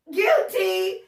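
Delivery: loud, high self-accusing cry; owning up with a big shout; playful shout of guilt. Real, not acted. Cheerfully owning up to her viewers, with a loud cry. Confessing she is the same.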